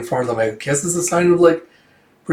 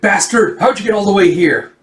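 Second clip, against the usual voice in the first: raised, high-pitched accusation; acted indignant outburst, pitched up; angry confrontation; 6 semitones higher, 4 dB louder than usual. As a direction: challenge the intruder. Pursuer confronts the intruder.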